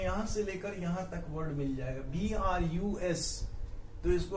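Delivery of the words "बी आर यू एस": letters spelled out slowly, spaced apart; slow spelling. Shown as a demonstration. Teacher slowly spelling the letters hidden in the word. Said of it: "spelling out letters".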